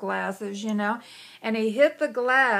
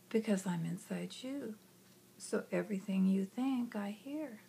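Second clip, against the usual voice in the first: much softer, fading near hush; soft inner voice; 10 dB quieter than usual. Retold or imitated. Inner voice answers the child, soft and hushed. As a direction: answer gently, almost a whisper.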